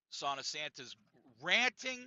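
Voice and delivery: usual speaking voice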